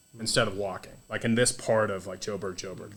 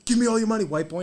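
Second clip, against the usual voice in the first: mugger's demand; 10 semitones higher, 3 dB louder, faster than usual. Retold or imitated.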